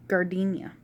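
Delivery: normal talking voice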